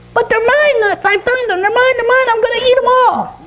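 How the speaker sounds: very high squeaky monkey voice; indignant falsetto monkey; possessive monkey. Acted. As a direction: squeal in protest like a greedy little monkey. Monkey protests over its nuts.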